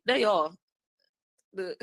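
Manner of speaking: normal talking voice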